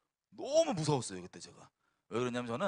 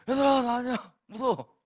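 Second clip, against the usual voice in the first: high, loud mock-terrified cry; tearful frightened plea; 8 semitones higher, 8 dB louder than usual. Testifier acting his helpless self pleading with friends, frightened cry. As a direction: plead in panic, acting out the fear. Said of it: "눈물 막".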